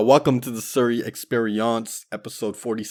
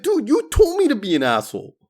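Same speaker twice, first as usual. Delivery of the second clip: much higher, whiny protest; high, nasal-free kid whine; whiny younger self; 4 dB louder than usual. Retold or imitated. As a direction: whine it like a confused thirteen-year-old. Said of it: "And I was like".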